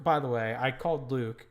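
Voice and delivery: ordinary delivery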